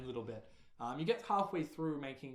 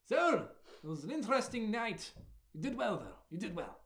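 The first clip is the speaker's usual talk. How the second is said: higher-pitched talking rabbit; animated, raised rabbit character voice; rabbit friend; 4 semitones higher than usual. Acted. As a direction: voice an eager little rabbit companion. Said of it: "your rabbit friend".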